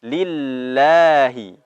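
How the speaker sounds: slow Lillahi with doubled lam held; Quranic recitation. Shown as a demonstration. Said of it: "lam bertasdid". (bacaan pelan dengan tasydid)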